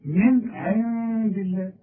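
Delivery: "من عند" with the nun hidden and nasalized before dal; ikhfa on nun. Shown as a demonstration. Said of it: "أخفي".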